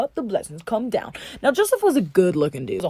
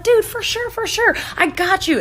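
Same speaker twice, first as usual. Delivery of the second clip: eager promise; 10 semitones higher, slower than usual. Acted. Cupbearer promising Joseph.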